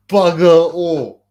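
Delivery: slow stretched loud repeat; drawn out. Shown as a demonstration. Streamer drawling his answer to a chat question. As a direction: drag each word out for effect. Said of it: "slow drawn-out repeat".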